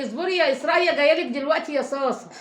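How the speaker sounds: high, loud call to someone in another room. Real, not acted. Calls out to daughter, telling her to wait. (تنادي على بنتها بصوت عالي تقول لها تصبر)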